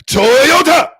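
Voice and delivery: put-on high, loud, angry caricature Japanese accent; shouted hammed-up Japanese impression; mock Japanese accent. Streamer trying Japanese for chat, shouted angry mock accent. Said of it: "I wanna get the Japanese one".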